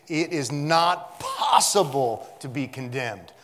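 slow, loud declaration hitting "possible"; emphatic quote. Retold or imitated. Preacher quotes commentator with force.

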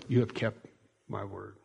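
very soft, low, hushed voice; tender whisper of God. Pretend. God tenderly reassuring his faithful people, hushed and soft. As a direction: speak it gently, almost a breath.

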